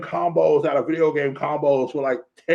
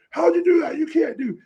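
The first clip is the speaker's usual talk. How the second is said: very high, louder flustered protest; mock astonishment; 4 dB louder than usual. Fan voices Hal losing ring to Batman, astonished. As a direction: squawk it in comic disbelief.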